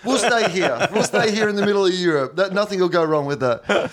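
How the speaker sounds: high, sarcastic English voice through laughter; hammed-up English voice, laughing as he goes; mock English settlers. Mocking the English staying behind.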